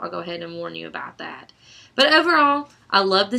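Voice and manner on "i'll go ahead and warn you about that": whispering. Real, not acted. Spoiler warning aside.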